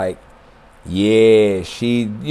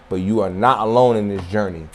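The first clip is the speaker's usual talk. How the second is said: softer repeat; 4 semitones lower, 6 dB quieter than usual. Shown as a demonstration. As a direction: lower and softer, sinking in.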